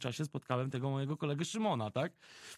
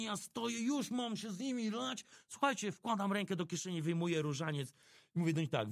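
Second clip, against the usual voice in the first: ordinary delivery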